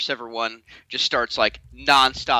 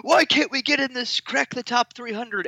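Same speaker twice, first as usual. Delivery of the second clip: mock whine; 11 semitones higher than usual. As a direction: whine it in a high voice.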